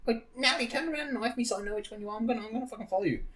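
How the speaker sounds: very high put-on child voice, gabbling fast; squeaky, insistent mock gamer child; twelve-year-old kid. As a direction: imitate a pestering kid nagging a teammate. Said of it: "this little fucking twelve-year-old".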